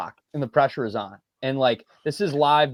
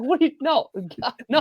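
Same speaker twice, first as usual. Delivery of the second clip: flustered denial; 11 semitones higher, faster than usual. Retold or imitated. Answering dad's worried text.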